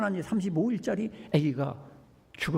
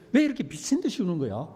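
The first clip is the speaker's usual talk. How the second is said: laughing; faster than usual. Retold or imitated. Grandfather's inner thought, laughing.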